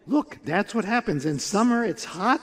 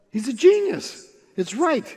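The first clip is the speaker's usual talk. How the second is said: high, loud burst of wonder; amazed exclamation; 6 semitones higher, 4 dB louder than usual. Acted. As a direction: exclaim it as a great revelation. Villagers amazed by the simple idea.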